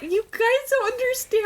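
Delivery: high-pitched tearful whine; imitating a weepy, worked-up protest; mock crying. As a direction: squeal it like you are bawling.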